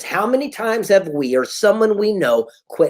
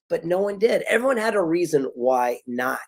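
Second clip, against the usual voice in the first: ordinary talk, nothing marked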